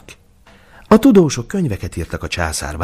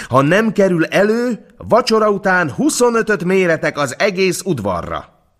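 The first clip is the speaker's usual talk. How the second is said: high, put-on imperial voice, indignant; an emperor offended he was never told, voiced higher; 12 semitones higher than usual. A narrator's reading. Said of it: "kiáltotta a császár".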